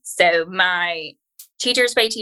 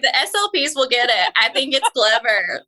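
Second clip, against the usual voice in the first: excited; 6 semitones higher than usual. Retold or imitated. Excitedly retelling own words.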